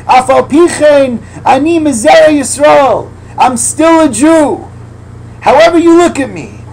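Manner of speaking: high, loud, slow rapture; fervent preaching. Acted. Preacher voicing the heart's prayer, loud and high. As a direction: cry out each phrase with joy.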